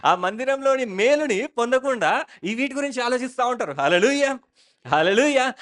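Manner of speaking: loud, high, slow preaching with hallelujah calls; preaching at full force. Acted. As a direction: preach it out to the congregation. (బిగ్గరగా బోధించే స్వరం)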